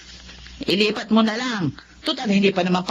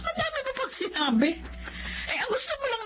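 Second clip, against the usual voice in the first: scornful mocking laugh; 8 dB quieter, faster than usual. Mother-in-law mocking daughter-in-law.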